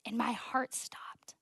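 breathy whisper, slow and hushed; whispered shock. Real, not acted. Telling her audience the moment her heart stopped, in a hushed slow whisper. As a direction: let the words barely escape.

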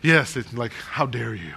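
lightly put-on pompous indignation; a touch of haughty affront; mock pompous. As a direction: imitate a self-important snob taking mild offense. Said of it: "the real pompous ones would, would say".

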